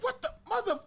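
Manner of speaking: very high-pitched cut-off curse; shocked outrage. Retold or imitated. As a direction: squeal the curse in retold disbelief. Acting out reaction to a spoiler.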